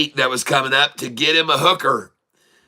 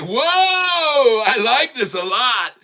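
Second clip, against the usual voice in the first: excited shout; 11 semitones higher, much slower than usual. Retold or imitated. Virgin boy thrilled by the woman, high excited shout. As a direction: yell it out, overcome with delight. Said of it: "and he's like".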